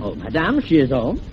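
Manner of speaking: put-on French accent. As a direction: ask politely with a phony French accent. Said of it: "talking like this with a French accent".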